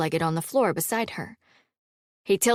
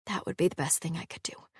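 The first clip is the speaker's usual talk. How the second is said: whispering; 9 dB quieter, faster than usual. Acted. Halla's private vow, trailing off.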